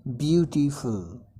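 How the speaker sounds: "ब्यूटीफुल" slowly split in three syllables. Shown as a demonstration. Teacher counting syllables for learners, "ब्यूटीफुल" slowly in three parts. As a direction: sound out all three beats of "ब्यूटीफुल". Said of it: "three आ रहे हैं इसमें".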